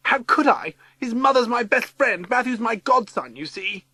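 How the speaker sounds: high-pitched, pleading justification; distressed woman explaining. A narrator's reading. Missus Summers excusing her silence about her godson, distressed.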